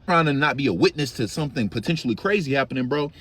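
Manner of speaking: ordinary delivery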